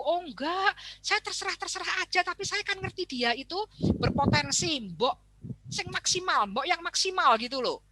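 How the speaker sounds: high, mildly defensive parent. Retold or imitated. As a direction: justify lightly. Parent explains to teacher.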